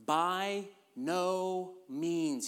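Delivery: very slow, every word weighed, "By no means"; a slow, deliberate, emphatic refusal; emphatic denial. Retold or imitated. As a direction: stretch "By no means" out slowly and firmly. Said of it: "Paul's unambiguous answer".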